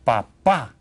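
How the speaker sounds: papá with the final pá stressed; stress on second syllable. Shown as a demonstration. Teacher pointing out the written accent, final-syllable stress on papá. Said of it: "stress the second syllable when you say".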